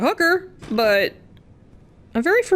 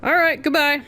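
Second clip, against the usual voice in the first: mock goodbye; 5 semitones higher than usual. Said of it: "Just kidding".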